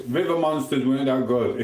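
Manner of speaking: normal talking voice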